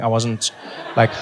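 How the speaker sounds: ordinary delivery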